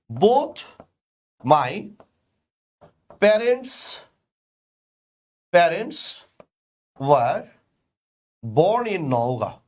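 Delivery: word by word with long pauses; slow dictation. Shown as a demonstration. Teacher building the example sentence, word by word. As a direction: dictate it slowly so the class can write. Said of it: "তাহলে আমরা বলছি".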